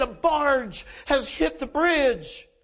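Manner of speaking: high, slow, emphatic report of disaster; urgent alarm. Retold or imitated. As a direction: drive each word home in alarm. Stranger breaking the disaster news.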